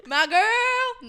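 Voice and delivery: high, loud mocking echo; hammed-up holler; boyfriend calling out. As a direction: yell it like an imitated couch-bound boyfriend. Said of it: "watching T V and he's like".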